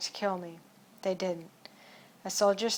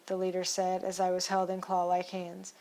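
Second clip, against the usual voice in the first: ordinary delivery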